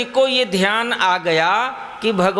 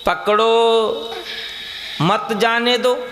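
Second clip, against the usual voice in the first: पकड़ो drawn out, then a pause before मत जाने दो; pause after पकड़ो; slower than usual. Shown as a demonstration. Preacher reads out the written words, pause after पकड़ो. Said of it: "पकड़ो के बाद विराम लगा दो".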